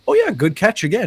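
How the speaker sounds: very high mock chirp; chirpy AI person. AI-as-person praising the correction, very high mock chirp. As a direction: chirp it out like a clueless bot. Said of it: "and he's just like".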